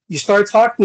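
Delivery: normal talking voice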